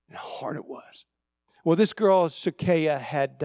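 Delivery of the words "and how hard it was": whispered aside, barely voiced; hushed. Real, not acted. Pastor whispering to the congregation about her struggle. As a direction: drop to a whisper as if it still hurts.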